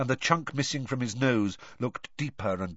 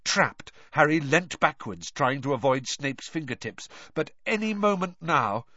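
usual speaking voice

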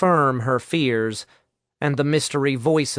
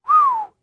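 impressed whistle; high, short whistle of amazement; 5 dB louder than usual. A narrator's reading. Nick hears two strong classmates went down, whistling. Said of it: "Nick whistled".

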